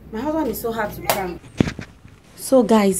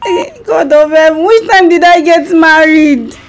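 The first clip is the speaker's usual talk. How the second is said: baffled, pleading to heaven; shocked, as if never married; amnesiac confusion; 3 semitones higher than usual. Pretend. Wife fakes amnesia prank call.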